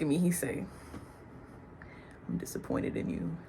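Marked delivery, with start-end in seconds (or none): 2.3-3.4 soft, breathy whisper of a let-down father; quiet disappointed father; quiet disappointment. Retold or imitated. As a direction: hush it, calm and heavy. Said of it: "he not angry, he not mad".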